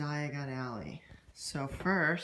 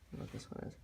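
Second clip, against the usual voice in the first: whisper; 10 dB quieter, much faster than usual. Real, not acted. Unsure about a mystery bean.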